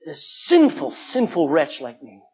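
acted plea to God, drawn-out words; imploring sinner's prayer, stretched for emphasis; desperate cry. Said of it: "If you have a desperate cry of".

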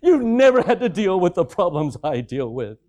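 a hint of a dramatic, put-upon teen; lightly voiced teen complaining; whiny teenager. Teenager telling dad he wouldn't understand, mildly whiny. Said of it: "dad looks and says".

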